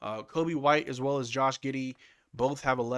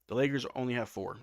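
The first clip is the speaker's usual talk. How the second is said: ordinary delivery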